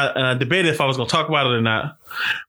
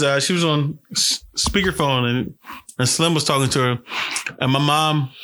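usual speaking voice

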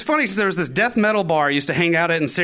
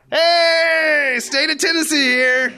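loud, higher call at the door; 10 semitones higher, 4 dB louder, much slower than usual. Retold or imitated. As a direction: announce yourself loudly through the door. Calling out at the door.

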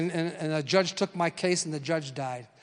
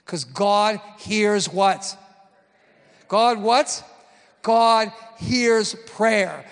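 slow, loud emphatic preaching; preaching call; 5 dB louder, much slower than usual. Acted. Preacher calling out to the church, slow and loud. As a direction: draw the answer out of the congregation.

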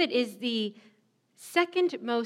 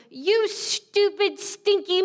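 high, slow mock-furious toy voice; a toddler's truck lashing out, high-pitched; angry monster truck; 8 semitones higher, 3 dB louder, slower than usual. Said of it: "lashed out and said".

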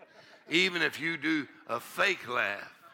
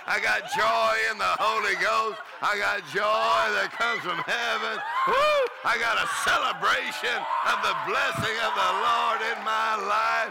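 joyful shout; 5 semitones higher, 4 dB louder than usual. Real, not acted. Preacher shouting with joy.

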